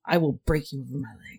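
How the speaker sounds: low growled barbarian threat. Pretend. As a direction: menace like a hulking brute. Repeats threat in deeper voice.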